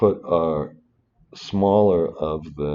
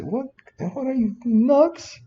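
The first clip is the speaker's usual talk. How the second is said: mock disbelief, much higher pitch; incredulous oil company; 3 dB louder than usual. Oil company scoffing at a lowball valuation, incredulous high mock outburst. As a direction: scoff like the oil company hearing a crazy offer. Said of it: "If I was the oil company, I'd say".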